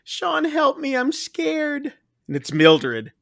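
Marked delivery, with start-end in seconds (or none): 0.0-1.9 much higher and softer, a frightened plea; pleading ghost voice. Retold or imitated. Mildred's voice calling to Sean from outside, soft high plea. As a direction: call out small and scared, as the trapped ghost. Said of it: "you hear this voice".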